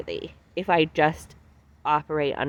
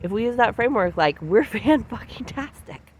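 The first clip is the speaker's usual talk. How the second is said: excited; 7 semitones higher than usual. Retold or imitated. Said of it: "these parts feel like".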